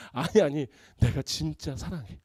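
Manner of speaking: soft, slow, sincere reassurance; softly insisting. Retold or imitated. To a doubting spouse, mock soft and sincere. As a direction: gently insist you really mean it.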